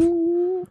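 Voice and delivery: high, drawn-out "ooh"; long falsetto ooh; high whoop. Real, not acted. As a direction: cheer on a guest's plug with a long high coo. Cheering the guest's news.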